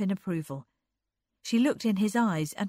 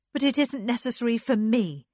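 raised, lightly scornful; higher, louder, faintly disdainful; slight contempt; 6 semitones higher, 6 dB louder than usual. A narrator's reading. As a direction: dismiss it with a touch of contempt. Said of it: "with slight contempt".

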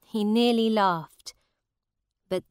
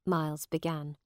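usual speaking voice

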